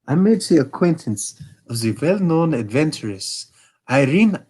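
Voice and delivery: a hint of a haughty German king; Bohemian king. King speaks.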